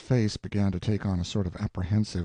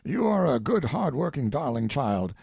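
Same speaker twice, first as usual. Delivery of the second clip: a hint of an eager old colonel, a little raised; old colonel sizing up a face, mildly excited; lightly voiced old colonel; 7 semitones higher, 4 dB louder than usual. A narrator's reading. Colonel to Tracy about a portrait, lightly voiced old man. Father refuses her protest.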